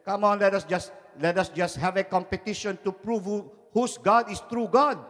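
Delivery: high-pitched impatient challenge; fed up. Acted. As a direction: press them to settle it, acted. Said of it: "got fed up with the argument".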